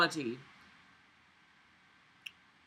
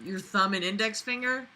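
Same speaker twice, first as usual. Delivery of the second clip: normal talking voice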